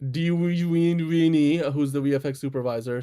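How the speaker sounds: ordinary delivery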